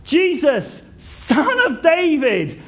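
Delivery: high, loud, drawn-out cry for mercy; shouting out. Acted. Blind beggar calling to Jesus on the road, shouting out. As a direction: call out slowly as if to reach someone passing. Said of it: "he is shouting out".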